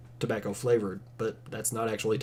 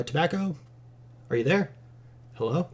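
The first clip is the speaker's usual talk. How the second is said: higher, questioning pretend search call; mock calling out; 6 semitones higher than usual. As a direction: pretend to call for someone hiding inside. Pretends to search device for tobacco.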